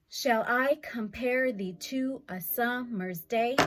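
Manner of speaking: "Shall I compare thee to a summer's day?" slowed, stresses on I, -pare, sum-, day; measured recital of the opening line; slow iambic reading. Shown as a demonstration. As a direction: read it out like a song. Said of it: "So it's gonna go".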